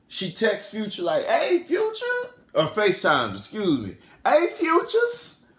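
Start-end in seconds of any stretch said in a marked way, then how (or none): 1.3-2.0 very high, sing-out greeting; high mock-girly hail; woman about to win. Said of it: "when women feel like they about to win". 4.2-5.5 put-on high, drawn-out girly call; mock-sweet high-pitched summons; woman about to win. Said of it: "that's how they talk".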